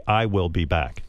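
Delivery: computer voice. Retold or imitated. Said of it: "a computer would say".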